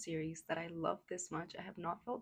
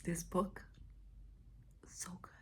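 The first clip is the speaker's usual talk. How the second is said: hushed whisper, choked up. Real, not acted. Vlogger whispering to viewers late at night, moved. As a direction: whisper so as not to wake anyone.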